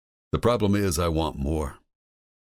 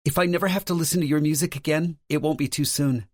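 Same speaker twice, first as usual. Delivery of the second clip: higher, lightly voiced woman; female character voice; 13 semitones higher than usual. A narrator's reading. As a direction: play Lauren, higher pitch.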